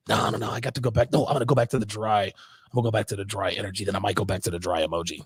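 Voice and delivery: low, soft, fast muttered thoughts; mock inner monologue. Mocking the woman's panicked thoughts, low and fast. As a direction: rattle off her panicked thoughts under your breath.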